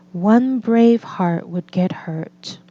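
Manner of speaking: usual speaking voice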